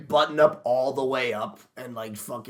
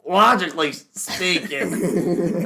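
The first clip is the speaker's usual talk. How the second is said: slow, slurred, higher drawl; drunk Ben Shapiro; 4 semitones higher, much slower than usual. Retold or imitated. Teasing friend as alcoholic Ben Shapiro, slow slurred drawl. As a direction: stretch it out like a tipsy pundit. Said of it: "and you're just like".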